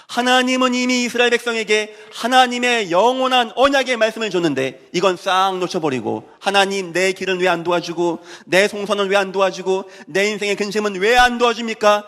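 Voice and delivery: sustained high-pitched sermon delivery; impassioned preaching. Real, not acted. Preacher chides those who ask God why He won't help, impassioned preaching. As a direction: press the point home at full pitch.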